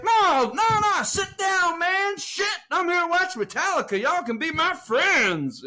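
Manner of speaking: high, excited big-guy voice; excited hippie. Retold or imitated. Hippie invites them to stay.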